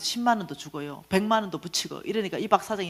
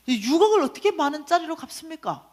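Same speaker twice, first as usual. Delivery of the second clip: incredulous protest; 8 semitones higher, 3 dB louder than usual. Retold or imitated. Protesting an impossible command to God.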